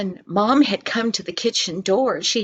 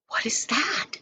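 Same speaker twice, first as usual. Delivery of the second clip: hushed whisper of a curious listener; 4 semitones higher, 6 dB quieter than usual. A narrator's reading. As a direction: breathe it, barely a sound. John wonders at a strange noise.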